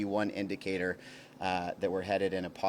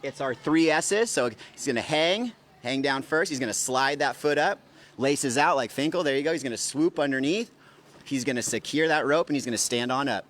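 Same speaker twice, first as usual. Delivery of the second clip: louder, higher step-by-step calls; coaching call-outs; 5 semitones higher, 6 dB louder than usual. Real, not acted. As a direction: call each move to the athlete like a coach. Coach calling rope-climb steps.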